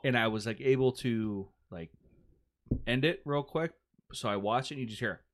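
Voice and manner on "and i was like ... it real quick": normal talking voice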